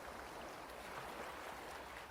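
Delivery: long, soft breathy exhale; long sigh. Shown as a demonstration. Meditation guide showing how to release a breath, sighing. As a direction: let the breath all the way out. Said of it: "let it out with a sigh".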